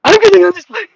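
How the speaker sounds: high, loud hammed-up shout; mock desperate yell. Traveller yelling in the queue to get on the plane, hammed-up. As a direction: bellow it like a frantic traveller.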